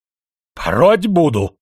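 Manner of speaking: slow, loud threat; shouted threat. A narrator's reading. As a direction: yell the threat slowly. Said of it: "выкрикнул Анатолий".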